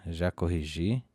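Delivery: ordinary talk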